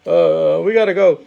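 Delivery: mock-sheepish wrestler backing off; nervous Sami Zayn. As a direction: play it cool then bail out. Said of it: "Sami Zayn's like".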